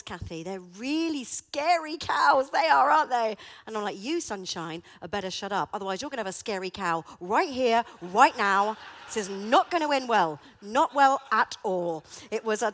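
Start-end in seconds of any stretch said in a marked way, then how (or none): 0.0-3.4 mock sympathy. Husband teasing her, laughing. 4.0-12.0 mock threat. Warning her laughing husband.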